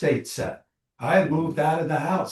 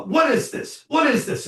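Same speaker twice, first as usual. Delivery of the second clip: much higher, mock-outraged calling; husband calling out; 10 semitones higher than usual. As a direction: call down the stairs in fake anger. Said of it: "he says".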